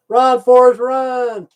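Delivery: loud shouted punchline; shouting. Retold or imitated. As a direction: yell it out like the famous line.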